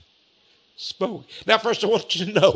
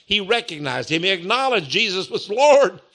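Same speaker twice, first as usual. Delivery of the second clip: normal talking voice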